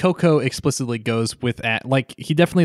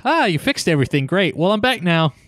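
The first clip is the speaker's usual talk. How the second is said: higher, breezy fast Celebi; a lightly voiced, higher smug Celebi; voicing Celebi; 6 semitones higher than usual. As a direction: toss it off like a smug sprite. Voicing casual Celebi on tree.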